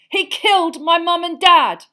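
high, very loud outcry; furious roar. A narrator's reading. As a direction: roar the accusation at full force. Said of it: "Harry roared".

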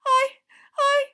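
very high, loud squeaky elf voice; gasping frightened elf. A narrator's reading. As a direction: gasp it out as a panicked little creature. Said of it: "Winky gasped".

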